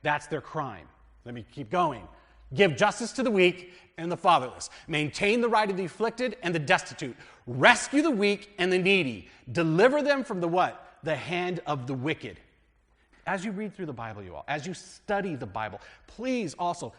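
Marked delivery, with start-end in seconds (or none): 2.5-12.4 raised, higher voice proclaiming the verse; scripture reading. Acted. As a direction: read it out like a preacher at the pulpit. Reads scripture aloud.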